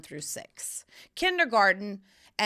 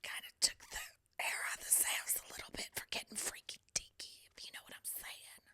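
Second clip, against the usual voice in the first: sly whispered aside; hushed, suggestive whisper; 12 dB quieter than usual. Real, not acted. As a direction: whisper it like a naughty secret.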